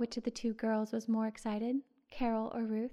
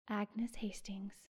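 in surprise; 7 dB quieter, much slower than usual. A narrator's reading. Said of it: "answered Ruth in surprise".

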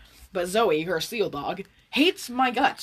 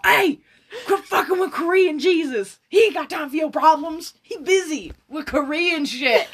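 high, loud, laughing movie-quote impression; 6 semitones higher, 4 dB louder than usual. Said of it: "[name] ... when he's all".

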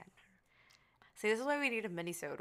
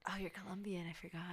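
hushed, almost whispered realisation; quiet aside; 10 dB quieter than usual. Real, not acted. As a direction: drop to a near whisper. Sheepish aside after a slip.

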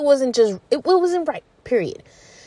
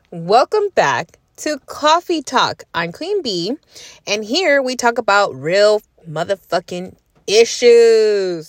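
podcast host intro; 3 dB louder, slower than usual. Shown as a demonstration. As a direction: announce it like a show opener. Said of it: "Let me rewind that".

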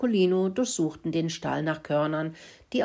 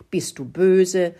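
slow, louder, stern emphasis; cross; 3 semitones higher, 7 dB louder, slower than usual. A narrator's reading. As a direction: lean hard and slowly on "böse". Mouse contrasts friendly with cross. (böse, laut und langsam betont)

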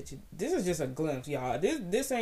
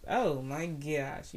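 ordinary talk

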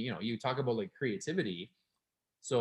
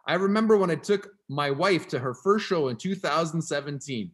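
ranting reader; 4 semitones higher, 7 dB louder than usual. Acted. Reading ranting Reddit post aloud.

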